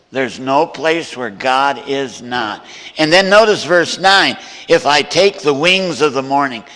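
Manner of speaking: loud, ringing pulpit preaching; full-force preaching. Acted. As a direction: preach it out to the whole room. Preaching verses to congregation.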